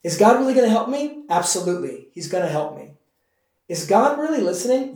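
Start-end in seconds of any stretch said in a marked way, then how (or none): 0.0-1.2 high, quick, worried asking; anxious doubt. Retold or imitated. Voicing doubting question. 3.7-4.9 high, worried, questioning; anxious doubt. Retold or imitated.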